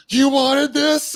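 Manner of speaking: very high, loud, stretched-out shout; retold frantic confrontation; acted-out yell. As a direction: yell it slowly as an accusation. Retold gun confrontation, yelling at her.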